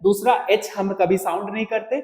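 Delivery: ordinary talk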